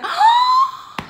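high shocked gasp of alarm; dramatic gasp. Retold or imitated. Vlogger retelling the traffic stop, dramatic gasp. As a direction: gasp like a soap heroine. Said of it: "yo de dramática".

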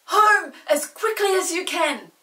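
loud, high, slow shout; Chinky shouting at the wishing chair to fly home. Acted. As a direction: yell the command to the chair. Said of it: "shouted Chinky".

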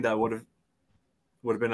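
usual speaking voice

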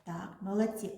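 ordinary talk

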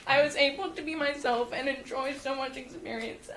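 ordinary talk

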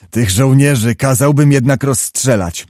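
usual speaking voice

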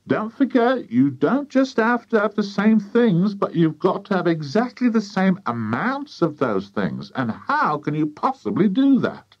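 high, insistent nagging; nagging farmer's wife. A narrator's reading. Wife lecturing on exact amounts, high insistent nag. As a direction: lecture them in a high, fussy voice.

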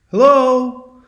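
high, loud sung-out echo; echoing high hello; Echo repeating. Retold or imitated. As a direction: parrot the call back, high and held. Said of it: "Echo can only repeat what you've said".

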